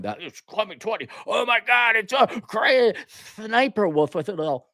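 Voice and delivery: ordinary talk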